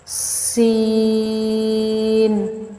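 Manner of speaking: سین drawn out very slowly with a clear whistling hiss; سین chanted slowly with a sharp hiss; hissed seen. Shown as a demonstration. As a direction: let سین whistle, firm and long. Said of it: "سین میں آپ نے سیٹی کی آواز نکالنی ہے".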